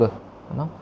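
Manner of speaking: normal talking voice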